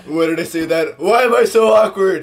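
mock panic. Voicing awkward inner monologue.

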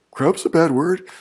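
high, loud baffled question; mock-perplexed kid voice, rising at the end; imitating a perplexed kid. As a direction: mimic a kid who just can't get it, pitch up. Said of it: "kept looking perplexed".

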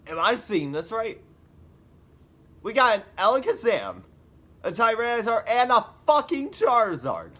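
high, loud amazed rush; excited. Real, not acted. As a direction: gasp it out starstruck. Sees famous team, amazed.

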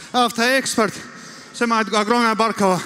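usual speaking voice

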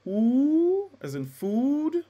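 "food" sung high and slow with a closed, rounded "oo"; closed U demo. Shown as a demonstration. Teacher singing the closed U for students, high and slow. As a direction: croon "U as in food" high and drawn out. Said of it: "your closed U".

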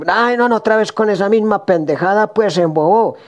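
protesta aguda de esposa harta; quéjate con fastidio y tono alto; esposa exasperada. Acted. (regaño exasperado)

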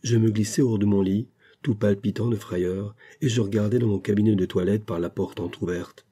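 ordinary delivery

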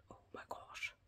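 barely audible, breath-only whisper; awed whisper. Real, not acted. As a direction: whisper it in amazement. Awed at another sticker.

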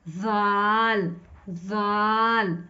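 जाल held very slowly; drawn-out zaal. Shown as a demonstration. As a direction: stretch the vowel in जाल. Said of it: "फिर उसके बाद हमारे पास आता है".

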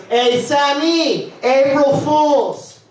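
slow sing-song April Fool's; uncle on the phone. Retold or imitated. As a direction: stretch it out like a goofy uncle. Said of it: "He goes".

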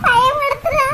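high keening wail, stretching the words; wailing lament. Acted. Sister wails to her friends about her suicidal sister, high keening lament. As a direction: cry it out like a sung lament.

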